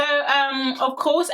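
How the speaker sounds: usual speaking voice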